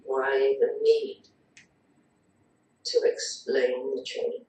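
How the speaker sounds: slow, half-whispered trance voice; channeled message. Acted. Channeler passing a spirit's message to the group, slow and hushed. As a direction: speak slowly, with long pauses, in a hushed otherworldly delivery. Speaking as channeled entity during session.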